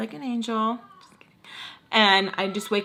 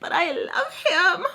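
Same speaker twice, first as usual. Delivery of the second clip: high, drawn-out tearful whine; mock wailing; 10 semitones higher than usual. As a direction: wail it like a lovesick melodrama. Retold tearful declaration of love.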